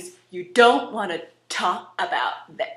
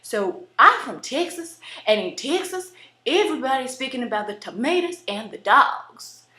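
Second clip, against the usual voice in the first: Texas accent; 3 semitones higher than usual. Shown as a demonstration. Texan speaking about tomatoes and dogs, Texas accent. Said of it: "you have the Texas accent".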